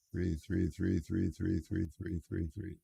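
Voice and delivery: muttering to self. Real, not acted. Searching chat for three.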